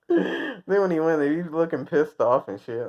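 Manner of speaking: usual speaking voice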